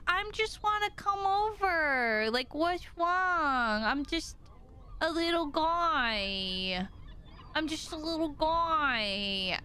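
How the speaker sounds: cutesy, slow, drawn-out little voice; innocent baby chick. Acted. Viewer voicing the wandering chick, innocent baby voice. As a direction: plead innocence in a babyish, stretched-out voice.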